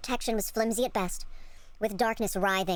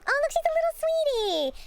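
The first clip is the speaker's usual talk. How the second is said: gushing coo; 12 semitones higher, slower than usual. Real, not acted. Streamer adoring the fox girl on screen, high cooing.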